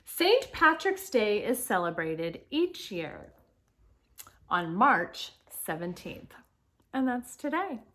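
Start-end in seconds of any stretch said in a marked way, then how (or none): 0.0-6.3 slow, clearly projected picture-book reading; reading to children. Acted. Teacher reads Saint Patrick's Day book to children, slow read-aloud. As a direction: read it out slowly and clearly for young listeners.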